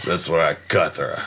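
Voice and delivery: bad Russian accent, low and laughing. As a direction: ham up a thick Russian accent. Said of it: "that's in Russian accent".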